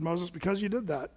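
soft, low pronouncement; hushed, understated rebuke from the Lord; grave verdict. Acted. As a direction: deliver the sentence quietly. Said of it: "the Lord said".

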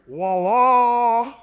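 long, loud, rising chant like a distant minaret; imitated call to prayer. Preacher mimics the morning call to prayer heard in Amman, chanted loud. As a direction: call it out like the muezzin over the speakers at dawn. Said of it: "this is what you hear".

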